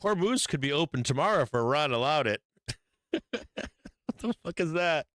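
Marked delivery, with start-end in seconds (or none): 3.1-5.1 laughing. Real, not acted. Laughing at absurd headline.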